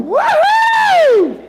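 very high, loud cheer; the Holy Spirit celebrating, very high and loud; wild whoop. Retold or imitated. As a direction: whoop with joy.

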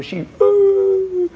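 high, loud crying "ooh"; imitated sob. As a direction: burst into a sob. Wife overwhelmed, breaking down.

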